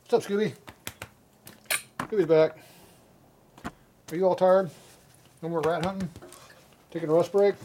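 high excited dog-greeting voice; talking to the dog. Real, not acted.

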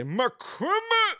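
very high, stretched "McCrimmon"; Zeke's Christmas. Retold or imitated. Imitating relative Zeke saying Christmas as "McCrimmon". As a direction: say "McCrimmon" squeaky and drawn out. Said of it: "he'll say".

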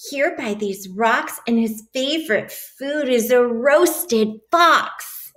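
lively picture-book read-aloud; animated storybook voice for a class; reading to children. A narrator's reading. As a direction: read it like story time for little kids.

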